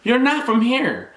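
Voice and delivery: high-pitched mock-surprised questioner; teasing, high put-on voice of curious strangers; mimicked nosy remarks. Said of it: "people used to always tell me".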